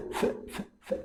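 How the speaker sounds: ف as a bare voiceless hiss, no vowel; unvoiced ف for the class; whispered f sound. Shown as a demonstration. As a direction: breathe out ف with no voice at all. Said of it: "حرف الإف صوته ف".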